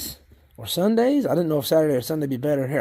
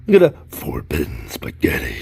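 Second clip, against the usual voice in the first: low put-on voice for forbidden spaghetti; mock spooky voice. Joking to viewers about worms, silly low voice.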